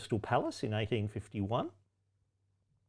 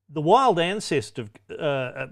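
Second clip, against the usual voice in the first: ordinary delivery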